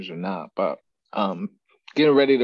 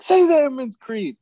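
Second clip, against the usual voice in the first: high, loud drill-sergeant order; barking instructor; 13 semitones higher, 6 dB louder than usual. Retold or imitated. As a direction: bark the command like a drill instructor.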